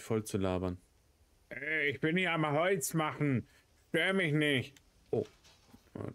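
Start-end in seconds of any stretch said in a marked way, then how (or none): none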